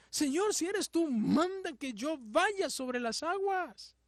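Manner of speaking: high, slow plea; Peter asking. Acted. Peter asks again, voiced.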